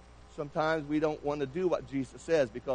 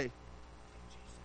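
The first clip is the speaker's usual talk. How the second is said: a barely audible whispered call; whispered name; 7 semitones lower, 20 dB quieter than usual. Pretend. Timidly calling Jesus from the crowd, whispered. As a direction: whisper it as if afraid to be heard.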